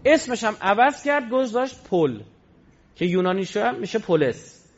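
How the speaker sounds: ordinary talk, nothing marked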